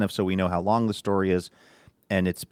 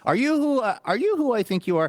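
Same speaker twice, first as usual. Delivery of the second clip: pitched way up, eager fan; a high, hammed-up starstruck fan; high mock fan voice. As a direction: squeal it like a starstruck stranger. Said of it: "having it be like".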